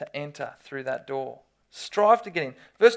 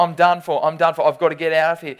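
mock panic; 2 semitones lower, 10 dB louder than usual. Imagined panic under water.

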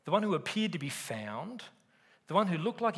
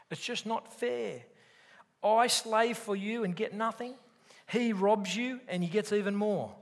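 higher childish complaint, lightly voiced; like a kid; 7 semitones higher than usual. Preacher voicing older brother to father, higher sulky kid. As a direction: whine it up like a kid who feels cheated. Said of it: "like a kid".

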